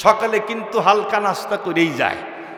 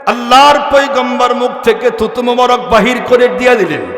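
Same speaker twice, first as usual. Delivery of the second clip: full-force preaching; 3 semitones higher, 6 dB louder than usual. Acted. Prophet orders Jaber to lift the lid, ringing preaching. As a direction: ring out the order, holding it long and loud.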